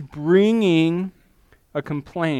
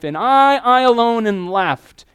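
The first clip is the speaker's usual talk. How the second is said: higher, louder, slow anguished cry; 6 semitones higher, 7 dB louder, slower than usual. Retold or imitated. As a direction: drag out the lonely lament. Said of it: "Elijah cries out to God and says".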